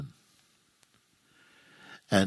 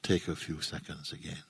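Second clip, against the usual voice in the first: low, soft voice with long silences between phrases; hushed, spacious meditation leading; guided meditation; 3 semitones lower, 6 dB quieter than usual. Real, not acted. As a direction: guide them gently into stillness, phrase by phrase. Leads a meditation.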